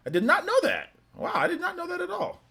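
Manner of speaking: high sing-song mock amazement; sarcastic surprise. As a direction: fake shock, teasing a friend.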